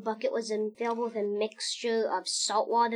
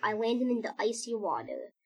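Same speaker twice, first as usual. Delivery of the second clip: ordinary delivery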